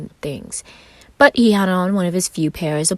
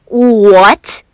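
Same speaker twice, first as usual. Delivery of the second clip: loud, higher indignant shout; angry outburst; 6 semitones higher, 14 dB louder than usual. A narrator's reading. Roman snapping at Virgil, loud and indignant. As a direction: blurt it out in a huff. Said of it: "Roman literally stamped his foot in anger".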